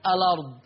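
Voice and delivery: "الأرض" run together as "alard", no hamza; hamza dropped. Shown as a demonstration. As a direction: give the lam of "الأرض" the fatha and skip the glottal stop. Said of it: "فتصير المحصلة". ("الأرض" بلا همزة، بلام مفتوحة: "أَلَرْض")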